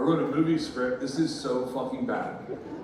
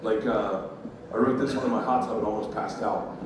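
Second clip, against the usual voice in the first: ordinary talk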